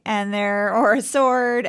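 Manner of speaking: normal talking voice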